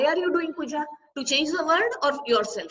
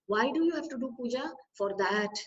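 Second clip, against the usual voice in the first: ordinary delivery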